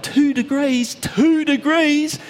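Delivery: high, incredulous repeat, the second slow; outraged disbelief. Retold or imitated. NASA engineer outraged at the passenger, high shocked disbelief. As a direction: spit the words out in disbelief. The guy nearly spat blood.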